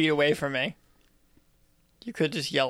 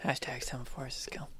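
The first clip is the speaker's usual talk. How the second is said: unvoiced, soft whisper; 4 semitones lower, 13 dB quieter than usual. Real, not acted. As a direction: breathe it out as an aside. Whispered aside at the table.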